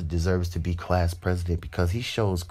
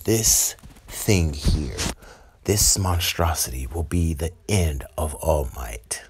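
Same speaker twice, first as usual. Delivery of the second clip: low, slow, whispery villain threat; sinister low villain whisper; menacing villain; 4 semitones lower, slower than usual. Retold or imitated. Villain Shigaraki presents his monster.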